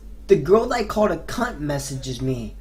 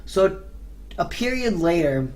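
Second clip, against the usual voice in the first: ordinary talk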